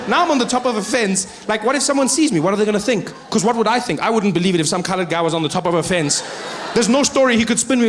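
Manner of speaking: normal talking voice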